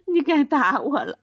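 words broken up by real laughter; laughing. Real, not acted. Wife laughing through her line about being hit.

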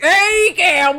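high, loud southern cowboy yell; southern western accent. Shown as a demonstration. Interrupting the sign-off, hammed-up southern holler. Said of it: "let's do a southern".

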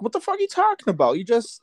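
high, fast incredulous outburst; incredulous quote. Retold or imitated. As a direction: blurt it out in disbelief. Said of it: "they were just like".

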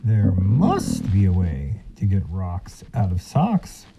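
sly silky fox. Acted. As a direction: announce it like a smug fox. Fox boasts solution.

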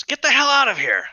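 high, loud shout of get out; angry yell. Acted. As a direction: yell them out of the room. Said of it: "the other goes".

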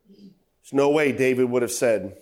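ordinary talk

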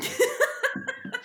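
laugh. Real, not acted. Laughing after choking fit.

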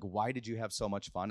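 soft, low curious question; genuinely wondering. Retold or imitated. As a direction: ask it softly, gently prying. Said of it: "I genuinely was wondering".

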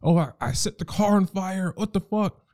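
hammed-up flustered girlfriend; sheepish confession. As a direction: play her admitting the fire. Partner voiced confessing mishap.

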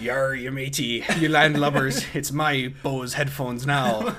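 hammed-up pirate growl. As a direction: play a gleeful pirate thief. Pretending to be a porch pirate.

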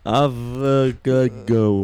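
put-on very slow, syllable by syllable like a child learning to read; slow halting child reading; sounding out syllables. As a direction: spell it out haltingly, as a first-grader. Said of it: "έλεγες".

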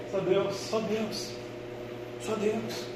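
dropped to a near-whisper; hushed. Shown as a demonstration. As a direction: breathe it softly, reverently. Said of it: "repeated much softer".

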